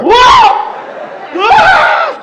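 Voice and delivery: high, loud whoa screams; screaming on the ride. Retold or imitated. Reliving the 3D motorcycle ride, screaming high and loud. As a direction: scream like you're flying on a thrill ride. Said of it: "Gonna scream like crazy".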